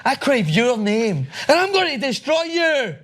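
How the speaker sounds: high-pitched mock threat; overdone vow of revenge, drawn out; mock outrage. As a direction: play-act being outraged, voice jumping high.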